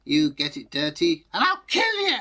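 mock-strict mother, peaking on kill; stern mother. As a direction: ham up a shrill warning mum. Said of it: "And her mother said".

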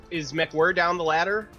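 normal talking voice

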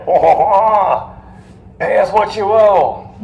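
loud, high mock French accent; hammed-up French gloating, belted out high. French demand repayment from the Americans, in hammed-up French accent. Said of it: "French accent".

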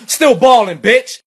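high, loud rapper ad-lib; bragging rap ad-lib, loud and high. Pretend. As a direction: hype it up like a drill rapper. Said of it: "I'ma [name] style stunt".

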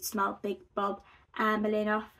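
plain reading voice

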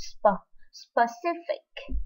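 specific said slowly, with the stress on the second syllable, spe-CI-fic. Shown as a demonstration. Teacher shows students the second-syllable stress in specific. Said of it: "mình nhấn âm thứ hai".

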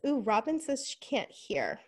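ordinary talk

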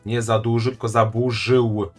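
normal talking voice

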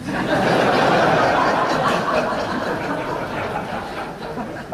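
breathy burst of laughter; laughing. Real, not acted. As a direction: laugh at the punchline. Audience-like laugh after punchline.

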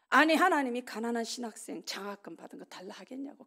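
ordinary talk

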